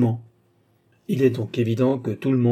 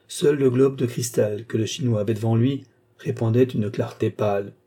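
usual speaking voice

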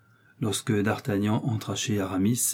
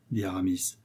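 usual speaking voice

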